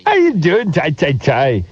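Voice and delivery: high cartoon Irish accent; mock Irish accent. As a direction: keep the jokey Irish lilt going. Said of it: "An Irish accent this time".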